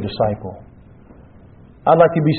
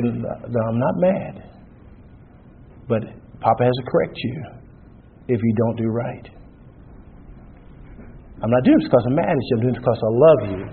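gentle reassurance; 4 semitones lower, 6 dB quieter than usual. Retold or imitated. Reassuring children being corrected.

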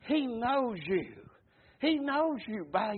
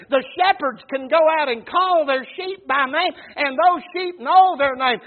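preaching; 5 semitones higher, 9 dB louder than usual. Acted. Preaching build.

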